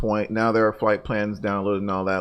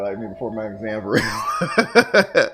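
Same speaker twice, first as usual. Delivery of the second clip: laughing; 6 dB louder, slower than usual. Real, not acted. Laughing at a chat joke.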